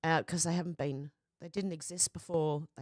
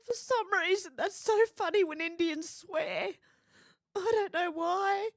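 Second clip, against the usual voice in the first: higher, giggly and slowed by laughter; giggling; 10 semitones higher, 3 dB louder than usual. Real, not acted. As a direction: fight to get words out through giggles. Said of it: "I cracked up".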